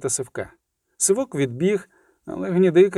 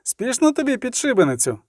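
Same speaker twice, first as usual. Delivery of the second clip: raised, higher-pitched challenge; shouted taunt; 7 semitones higher than usual. A narrator's reading. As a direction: call mockingly after a fleeing comrade. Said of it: "крикнув Скиглик".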